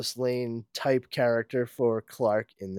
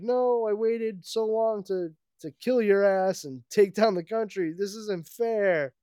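whiny villain; 8 semitones higher than usual. Acted. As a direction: whine like a sore loser. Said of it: "Lex freaks out".

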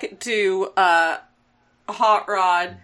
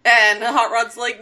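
normal talking voice

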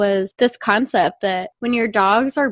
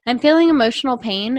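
normal talking voice

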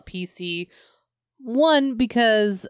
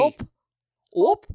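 little Midwestern oops noises; Midwest ope; 9 semitones higher, 4 dB louder than usual. Acted. Host caps the mom bit with Midwest opes. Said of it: "Midwest Ope style".

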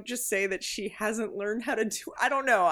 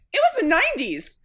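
louder and higher, an incredulous outburst; exasperated exclamation; 8 semitones higher, 6 dB louder than usual. Retold or imitated. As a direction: blurt it out in disbelief. Reacting to swearing in the film.

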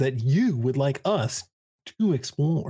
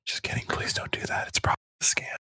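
soft, breathy aside whispered under the breath; hushed whisper; 6 dB quieter, faster than usual. Real, not acted. Cohost whispers to listeners taking back the scam endorsement, whispering. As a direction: drop to a secret whisper to take the joke back.

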